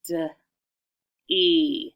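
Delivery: "D, E" as separate sounds, duh, then long ee; sounding out. Shown as a demonstration. Teacher tapping out first syllable, "D, E" sounded separately. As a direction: isolate the "D", pause, stretch the "E". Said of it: "Let's try this".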